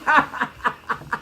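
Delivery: high, real laugh; amused high chuckling. Real, not acted. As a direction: crack up at your own joke. Laughing at own song joke.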